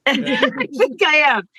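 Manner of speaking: laughing it out, slowly. Real, not acted. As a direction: laugh through the admission. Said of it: "I think you're having a stroke".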